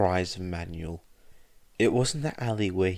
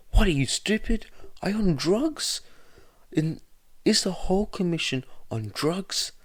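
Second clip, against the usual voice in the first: furious rant; 8 semitones higher than usual. A narrator's reading. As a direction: tear into him, outraged. Midoriya's furious rant at Suzuki.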